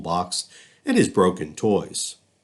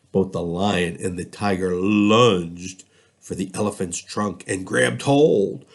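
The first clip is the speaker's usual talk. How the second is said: usual speaking voice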